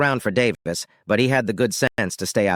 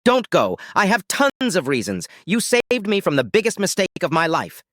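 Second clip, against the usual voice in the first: higher-pitched desperate plea; gasped pleading; 8 semitones higher than usual. A narrator's reading. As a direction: plead urgently to stop him leaving. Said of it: "She gasped like an asthmatic".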